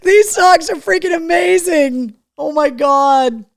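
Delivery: thrilled exclamation; excited. Real, not acted. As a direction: gush over the socks. Delighted by gift.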